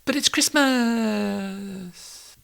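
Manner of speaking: high, slow, soft whiny wife. Acted. Wife voiced in couple sketch.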